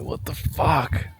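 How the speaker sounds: slow, awed whisper. Real, not acted. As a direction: breathe it out in disbelief. Awed by goats up close.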